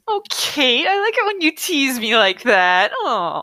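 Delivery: put-on lovestruck gushing; mock gushing. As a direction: coo it with exaggerated delight. Mocks the book with invented reply.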